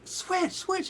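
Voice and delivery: high eager call of the band's name; excited fan calling out. Retold or imitated. Fan in the lobby spotting the band, excited calling. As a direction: imitate a star-struck girl calling out. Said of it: "She's like".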